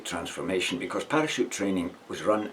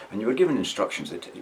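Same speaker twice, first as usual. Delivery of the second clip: normal talking voice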